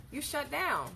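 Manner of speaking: ordinary talk, nothing marked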